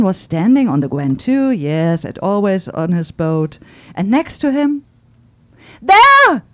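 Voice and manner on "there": high, loud excited cry; overexcited waitress crying out, high and loud; cried out. Acted. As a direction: point and cry it out. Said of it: "repeated Laurine".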